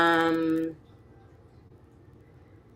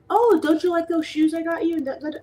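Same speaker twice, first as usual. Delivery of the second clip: mocking gift giver; 12 semitones higher than usual. Mocking guilt-tripping gift givers.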